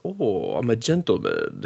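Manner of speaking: a hint of a lazy gentleman; drawn-out gentleman. Retelling Jack the Ripper introducing himself, drawn out. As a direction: drawl it like a dandy. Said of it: "drawn out".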